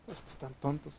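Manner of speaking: soft, scornful aside from the crowd; a hushed jeer, lightly voiced; mocking quote. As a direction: sneer it under your breath. Quotes people mocking believers.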